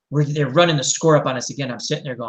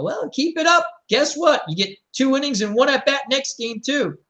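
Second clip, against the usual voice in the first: taunting coach; 8 semitones higher than usual. Retold or imitated. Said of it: "I go".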